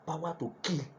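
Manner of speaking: normal talking voice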